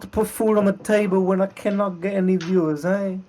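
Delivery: high-pitched put-on character voice; comic character impression. Streamer lamenting lost viewers, in a high hammed-up voice. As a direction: put on a high voice and plead hard-up. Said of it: "That's a very good impression".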